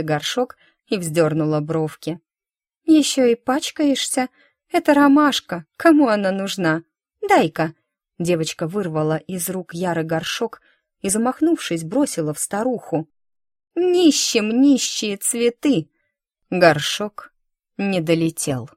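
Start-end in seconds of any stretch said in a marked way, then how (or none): 2.8-7.7 put-on high, louder disdainful girl; snobbish girl. Snobbish friend scorns the flower. 13.7-15.9 put-on slow, higher scornful girl; spiteful taunt. Friend throws pot at beggar.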